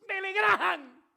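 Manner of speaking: high, loud mocking yell back. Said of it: "más duro me devolvió ella".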